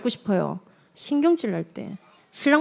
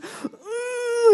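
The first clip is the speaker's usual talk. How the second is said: high, squeezed effort noise; straining pinch grunt; 11 semitones higher than usual. Shown as a demonstration. Showing how a child pinches a sibling, straining grunt. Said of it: "탁 꼬집으면서".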